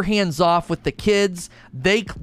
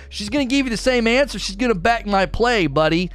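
higher, faster teasing retort; playful dad warning; 6 semitones higher than usual. Retold or imitated. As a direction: warn the kid with a smirk. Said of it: "I was like".

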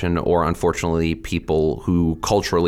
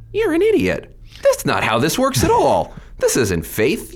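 high-pitched, exasperated snap at an idiot; retold angry retort. Snapping back at a Facebook idiot over Robin Williams, exasperated. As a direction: fire it back in disbelief, pitched way up. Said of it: "And I was pissed off".

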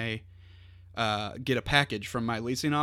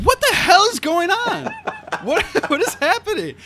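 high, loud, laughing disbelief; baffled; 8 dB louder than usual. Retold or imitated. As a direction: squeal it in laughing confusion. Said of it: "I was like".

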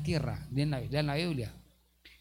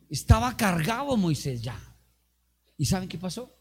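usual speaking voice

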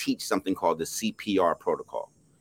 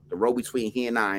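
usual speaking voice

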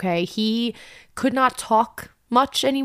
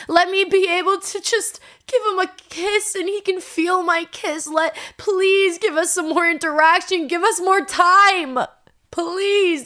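high, loud, pleading through tears; tearful prayer; 8 semitones higher, 4 dB louder than usual. Retold or imitated. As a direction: beg God, voice breaking. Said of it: "I start hysterical crying".

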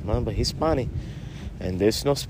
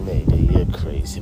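normal talking voice

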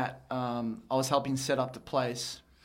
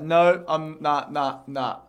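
loud mock denial; 7 dB louder, slower than usual. Acting out denial.

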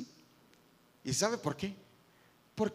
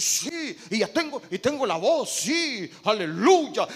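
showy preacher; 2 semitones higher, 7 dB louder, slower than usual. Retold or imitated. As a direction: ham up the performing preacher. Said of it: "Y decir". (predicador teatral, fuerte y agudo)

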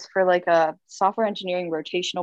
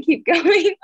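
breaking into laughter, higher and louder; laughing; 9 semitones higher, 6 dB louder than usual. Real, not acted. Telling her friend how the interviewer insisted she continue, laughing. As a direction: laugh through the quote.

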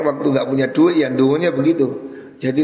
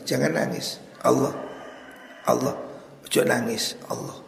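dying father's words; 7 dB quieter than usual. Retold or imitated. Dying father comforting his family.

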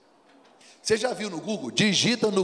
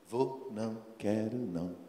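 calm refusal; 8 semitones lower, 8 dB quieter than usual. Acted. Jesus refusing again.

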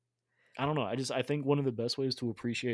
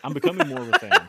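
laughing; 12 dB louder than usual. Real, not acted. Laughs at Moss Gardens question.

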